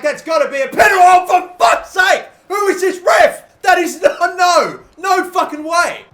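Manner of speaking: real outraged yelling at the ref, high and loud; furious shout. Real, not acted. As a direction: roar it out in disbelief at the call. Denied a penalty in game.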